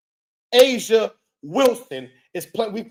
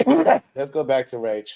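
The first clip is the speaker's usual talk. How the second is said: laughing; 7 semitones lower than usual. Real, not acted. Laughs while repeating.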